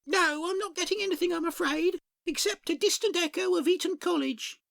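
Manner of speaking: a beaver accepting a compliment; high beaver voice. Acted.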